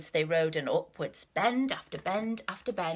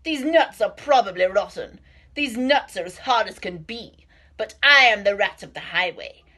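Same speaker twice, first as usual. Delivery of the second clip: snarling rat; 3 semitones higher, 9 dB louder than usual. Acted. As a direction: growl it at the squirrel. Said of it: "snatched the sack and snarled".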